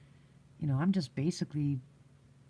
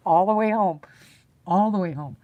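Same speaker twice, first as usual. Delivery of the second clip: loud, higher, amused outburst; gleeful exclamation; 3 semitones higher, 10 dB louder than usual. Real, not acted. Storyteller recalling laughing at friends, gleeful exclamation. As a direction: belt it out straight after laughing.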